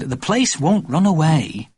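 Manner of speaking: slow, leisurely reply; unhurried Mummy. A narrator's reading. Mummy calmly answering Daddy's fretting, slow and easy. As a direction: take your time with it.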